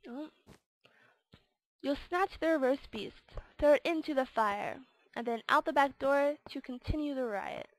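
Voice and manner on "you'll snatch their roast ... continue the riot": louder, rhythmic storybook narration; game verse read-aloud. Acted. Gamer reading out the Grinch quest, rhythmic storyteller voice. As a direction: declaim the rhyme like a mischievous storyteller.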